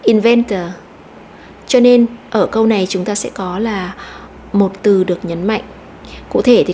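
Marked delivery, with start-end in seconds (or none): 0.0-0.6 Inventor with the middle syllable VEN stressed; in-VEN-tor, second syllable punched. Shown as a demonstration. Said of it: "nhấn mạnh vào âm tiết số hai".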